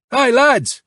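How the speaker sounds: high, calling out to the lads. A narrator's reading. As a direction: call out cheerfully. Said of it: "he called out in Ukrainian".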